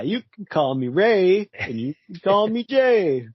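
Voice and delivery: high, drawn-out comic catchphrase; catchphrase character. Acted. Co-host quoting that AJ guy's routine, hammed-up catchphrase voice. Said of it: "That A J guy".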